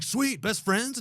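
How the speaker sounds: excited squeaky ask; eager high question. Retold or imitated. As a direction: pitch it high and hopeful. Acted eager library quote.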